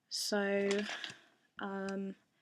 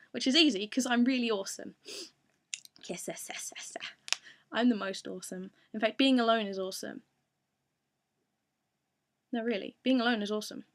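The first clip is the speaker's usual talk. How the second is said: brash, boastful, louder Prussia; 8 dB louder than usual. Pretend. Fan requests Prussia, so she boasts loudly as him. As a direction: swagger like a self-proclaimed awesome Prussia. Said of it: "imitate Prussia".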